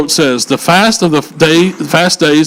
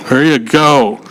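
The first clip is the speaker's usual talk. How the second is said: normal talking voice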